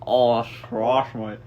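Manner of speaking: a light mock Australian accent; Aussie twang on trash, mate; playful hint of an Australian accent. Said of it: "in an Australian accent".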